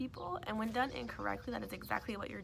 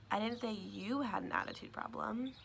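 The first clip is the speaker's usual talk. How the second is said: "you" lifted and stretched above the other words; stress on YOU. Shown as a demonstration. Teacher showing a second meaning of the sentence, stress on YOU. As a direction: put the weight on "you". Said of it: "putting emphasis on different words".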